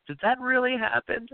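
can't believe the news; high mock disbelief; surprised. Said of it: "I was like".

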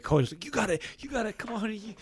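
a hint of an eager birth coach; coaching his wife. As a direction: pitch rising high, pumping her up through labor. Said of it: "because I was like".

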